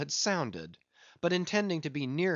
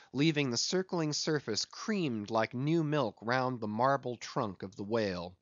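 ordinary delivery, nothing marked

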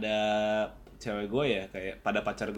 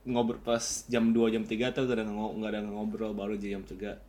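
ordinary talk, nothing marked

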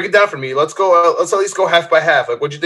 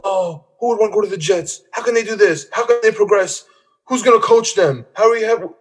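higher mocking whine of a panicked pundit; higher, mock-frantic pundit voice; mocking Booger; 7 semitones higher than usual. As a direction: mimic a pundit losing it over the Jets. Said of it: "freaking out about".

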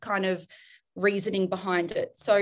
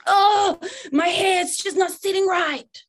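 put-on teen daughter; 9 semitones higher, 6 dB louder, slower than usual. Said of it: "said to me".

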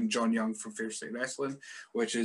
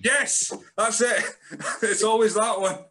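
much higher, louder, laughing; laughing agreement; 10 semitones higher, 8 dB louder, slower than usual. Real, not acted.

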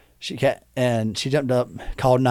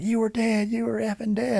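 imitating frantic wife; 10 semitones higher, 4 dB quieter than usual. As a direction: mimic a wife insisting in disbelief. Acts out frantic wife insisting.

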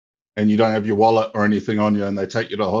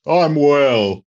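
commentator voice; 5 semitones higher, 3 dB louder than usual. Acted. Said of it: "your commentator voice".

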